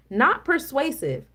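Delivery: ordinary talk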